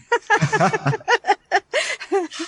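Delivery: long high-pitched laugh. Real, not acted. Laughs after greeting listeners.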